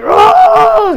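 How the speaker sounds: very high, loud shriek of pain; agonised scream. Acted. Diavolo shrieking while the coroner cuts him open. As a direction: shriek as you are cut open. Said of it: "He's screaming out".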